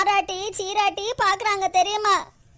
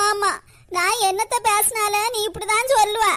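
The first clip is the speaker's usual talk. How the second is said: ordinary talk, nothing marked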